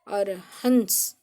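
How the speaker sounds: हंस said slowly and drawn out; slow teaching demo of हंस. Shown as a demonstration. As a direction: stretch हंस so the class hears it. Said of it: "माना हंसना".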